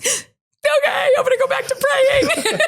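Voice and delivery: very high, laughing through the words; giddy laughing. Retold or imitated. On hearing the show was greenlit, very high and laughing. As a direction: burst out in delighted disbelief.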